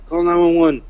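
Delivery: loud, high urgent call; retold scream for help. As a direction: yell it again to get help. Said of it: "I started screaming".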